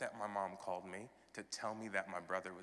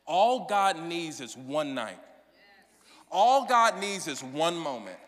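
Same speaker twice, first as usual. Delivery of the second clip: full-force preaching; 8 semitones higher, 13 dB louder than usual. Acted. Pastor preaching to his congregation, loud and ringing. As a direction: preach it out to the whole church, building on each repeat.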